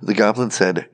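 usual speaking voice

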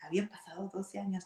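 ordinary delivery

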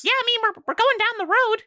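high hag excuse; Hagatha voice. Acted. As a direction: whine it like an old hag. GM answers as Hagatha.

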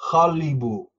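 Qalipu with a throaty guttural Q, "kha-LEE-boo"; teacher showing Qalipu. Shown as a demonstration. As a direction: put the guttural Q in front of libu. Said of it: "add on to the front of that the awkward guttural Q".

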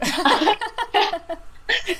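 bright high-pitched giggle. Real, not acted. Laughing at her own build-up, a high giggle. Laughs after hyping story.

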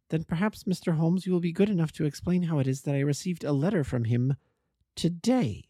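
wife welcoming the detective, composed but anxious. A narrator's reading. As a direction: play the brave hostess. Narrator voices Mrs St Clair.

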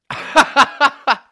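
sudden high burst of laughter; loud laugh. Real, not acted. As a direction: crack up loudly.

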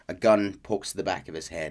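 usual speaking voice

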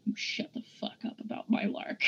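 mock menacing whisper; hushed, unvoiced-breath threat in jest. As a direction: whisper it as a protective warning. Rose Thorn's imagined protective threat.

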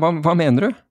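high-pitched mock bewilderment; acted puzzled reply; puzzled comeback. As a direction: throw the question back, baffled. Student's puzzled reply, retold.

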